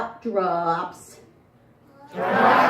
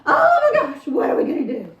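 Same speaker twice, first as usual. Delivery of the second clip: much higher, louder, frantic half-laughing panic; panicked Mama; 12 semitones higher, 6 dB louder than usual. Acted. Mama fears hanging for the preacher's death, frantic. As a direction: wail it like she'll hang for murder. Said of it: "Mama says".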